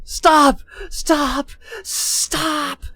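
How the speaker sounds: high, loud desperate screams of stop; screaming. Acted. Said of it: "he just starts screaming".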